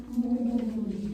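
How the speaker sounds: "Moon" loud with heavy stress; accented moon. Shown as a demonstration. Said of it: "every single time you say moon".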